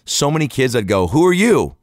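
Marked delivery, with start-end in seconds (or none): 1.1-1.8 high, loud costume challenge; loud questioning. Retold or imitated. Adult to costumed kid, high loud questioning. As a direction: call it out at a trick-or-treater. Demanding costume of kids, acted.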